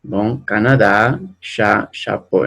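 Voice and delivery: slow, clear Canadá and chapeau, each ending stressed; pronunciación lenta y clara; slow model reading. Shown as a demonstration. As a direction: read Canadá and chapeau slowly for the class. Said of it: "subrayando las palabras en la última sílaba".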